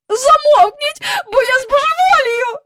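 high, loud desperate shout. A narrator's reading. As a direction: yell to silence them. Mother yells at noisy children.

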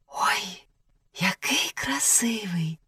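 soft, awed whisper. A narrator's reading. Thumbelina confiding in the swallow about the prince, whispered. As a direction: whisper it in admiration. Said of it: "прошепотіла дюймовочка ластівці".

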